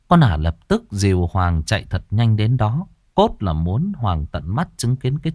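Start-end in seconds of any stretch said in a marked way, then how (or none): none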